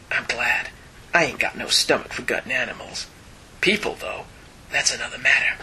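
quiet hard-boiled inner monologue; low, hushed Wolverine narration. Acted. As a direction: murmur it like a tough guy sneaking past dogs. Reads Wolverine's narration boxes.

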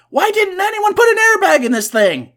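very high, loud acted shriek; panicked complaint. Imagined pilot's thought while being shaken, shrieked complaint. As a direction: wail it in comic outrage. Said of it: "three things are going through his mind".

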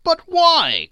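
high, loud, pleading cry. Acted. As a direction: burst out in protest. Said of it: "cried Scrooge's nephew".

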